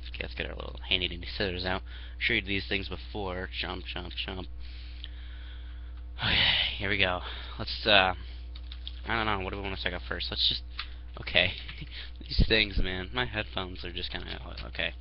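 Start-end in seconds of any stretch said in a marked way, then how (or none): none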